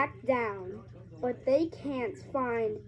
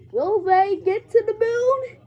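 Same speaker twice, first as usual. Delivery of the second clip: excited; 9 semitones higher, 9 dB louder than usual. Acted. Dramatic story question.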